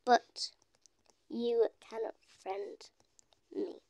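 halting near-whisper; muttered typing. Real, not acted. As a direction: whisper each word separately as you type. Sounding out words while typing.

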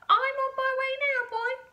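put-on high, loud old-lady holler; calling down. A narrator's reading. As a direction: holler triumphantly to the boy below. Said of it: "she called down to George".

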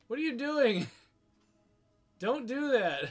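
loud, high frustrated yell; upset yelling. Real, not acted. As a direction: shout it out in disbelief. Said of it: "confused and upset and pissed".

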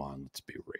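whispered aside; breathy unvoiced whisper. Real, not acted. Aside to viewers.

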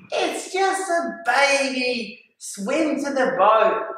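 high, slow, drawn-out call; imitated dismissive call. Friends calling back to the frightened diver, slow high dismissive call. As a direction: stretch it out as if calling over water.

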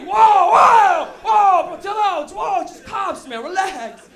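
high, very loud shouted halt; alarmed interruption. Acted. Friend telling the freaking-out passenger to relax, alarmed shout. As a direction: cut him off at full volume, easing slightly.

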